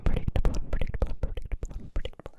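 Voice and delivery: crisp whispered trigger words, repeated; ASMR trigger words. Shown as a demonstration. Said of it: "some more trigger words".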